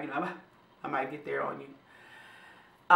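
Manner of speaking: holding back tears. Real, not acted. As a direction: speak softly while holding back tears. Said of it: "I'm trying not to start crying".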